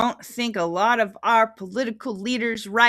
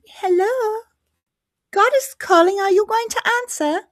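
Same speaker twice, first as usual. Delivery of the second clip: high, loud put-on caller voice; God on the phone; 6 dB louder than usual. God calling the listener, high playful voice. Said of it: "Be like".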